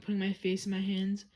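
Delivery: plain reading voice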